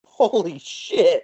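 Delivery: slow, drawn-out disbelief; stunned. Real, not acted. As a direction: let the shock sink in. Reacting to stunt fact.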